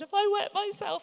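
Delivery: sudden high squeak of a question; high startled mock panic; alarmed question. Asking instructor in alarm.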